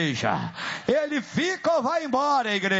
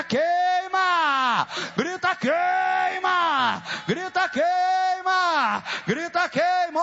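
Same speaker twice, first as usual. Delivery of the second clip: raised, stretched-out cry on every "Queima"; forceful rallying cry; 4 semitones higher, much slower than usual. Shown as a demonstration. Preacher urging the church to yell against the demon, forceful rallying cry. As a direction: lead the crowd in a high, drawn-out battle cry. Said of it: "Grita forte".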